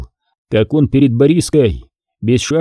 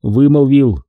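ordinary delivery, nothing marked

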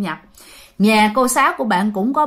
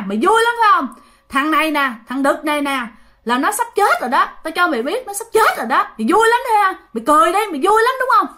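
possessed angry shout; 7 semitones higher, faster than usual. Retold or imitated. Possessed father at the Tet meal taunting his brother, high angry shout. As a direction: shout like a possessed man foretelling a death. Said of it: "ba của bạn rất hung dữ nha".

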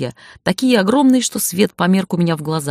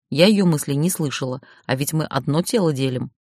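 ordinary delivery

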